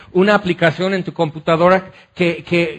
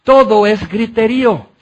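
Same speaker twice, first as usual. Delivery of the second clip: louder, higher, building sermon climax; fervent preaching; 3 semitones higher, 3 dB louder than usual. Acted. Preacher exalting Jesus' beauty to the congregation, fervent and loud. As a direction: pour it out to the congregation, rising in fervor.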